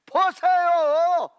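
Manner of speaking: slow loud high "보세요!"; angry shout. Retold or imitated. Preacher imitating the furious king, loud and drawn out. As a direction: bark it out in anger, dragged long. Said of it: "막 이러는 거죠".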